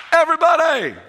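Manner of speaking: loud, high call to a huge crowd. Retold or imitated. Jesus calling out to the crowd of thousands, loud.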